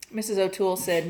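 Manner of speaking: usual speaking voice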